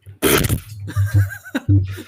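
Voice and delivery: very high squealing burst of laughter; real high-pitched cackle at his own joke; shrieking laugh. Real, not acted.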